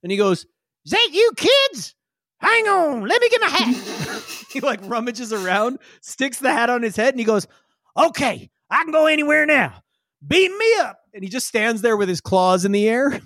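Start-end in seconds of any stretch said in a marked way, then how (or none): 0.8-4.2 very high-pitched character voice calling up; excited Wally voice. Acted. Wally calling up to the kids, high excited character voice. 8.0-11.0 high character voice climbing higher; eager Wally voice. Acted. Wally asking to be beamed aboard, high eager character voice.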